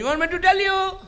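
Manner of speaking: high, slow drawn-out call. Retold or imitated. Handcuffed Misam taunts Ibn Ziyad, calling out slowly. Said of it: "پکار کے کہا".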